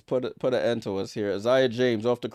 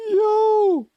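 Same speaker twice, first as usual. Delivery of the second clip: high yelping yo, much louder; shrieked yo of pure disbelief; disbelieving shout; 6 dB louder than usual. Real, not acted. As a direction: cry out in disbelief at the turnover. Team blunder, fan reacts.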